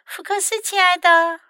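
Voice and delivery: high-pitched motherly call. A narrator's reading. Mom calling her hiding child Fox, high calling voice. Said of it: "她叫道".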